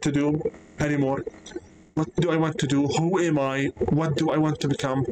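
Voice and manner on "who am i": ordinary delivery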